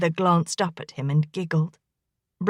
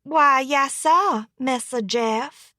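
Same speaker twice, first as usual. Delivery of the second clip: higher, slow Southern servant's drawl; playful Southern drawl, pitched up and drawn out; 6 semitones higher, slower than usual. Acted. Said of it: "mimicked the best drawl she knew".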